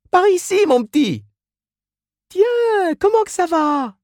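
very high put-on woman's voice; chatty saleswoman. A narrator's reading. Miss Inez greets Mrs Reilly at counter, chatty. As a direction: greet a regular in a high squeal.

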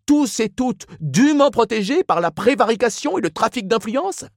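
high-pitched tirade, climbing through the list; pompous, indignant Ignatius. A narrator's reading. Ignatius harangues policeman about the city's vices, pompous indignation.

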